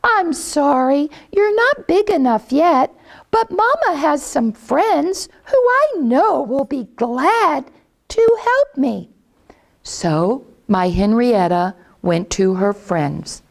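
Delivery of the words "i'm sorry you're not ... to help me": high, sweet mother hen talking to her chicks; mama hen coaxing her babies. Acted.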